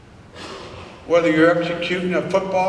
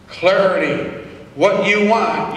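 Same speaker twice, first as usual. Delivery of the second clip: ordinary talk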